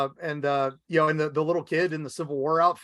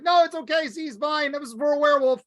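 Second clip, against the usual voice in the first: much higher, flustered pleading; hammed-up nervous doctor apologising to the room; nervous doctor excuse; 10 semitones higher, 3 dB louder, faster than usual. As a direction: talk your way out of an arrest in a high panic. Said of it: "he's like".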